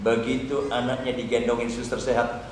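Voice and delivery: ordinary talk, nothing marked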